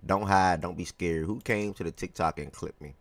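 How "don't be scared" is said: ordinary talk, nothing marked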